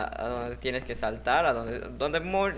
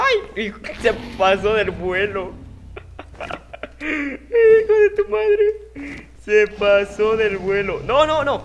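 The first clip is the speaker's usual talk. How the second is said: high, drawn-out anguished yelling; frantic screams; 7 semitones higher, 9 dB louder, slower than usual. Real, not acted. Gamer screaming as his character overshoots, anguished. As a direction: wail at the overshot jump.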